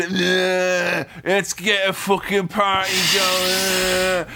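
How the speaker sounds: put-on slurred, slow drunken Bond; hammed-up drunk Bond slurring his words; mock drunk Bond. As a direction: play Bond sloppy drunk, groaning. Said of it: "big drunk Bond who's like".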